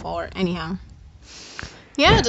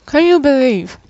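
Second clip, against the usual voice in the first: talking through a crunchy bite; crunching cookies; 3 semitones higher, 8 dB louder than usual. Real, not acted. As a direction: mouth full of crunching cookie. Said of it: "Sorry for the crunching".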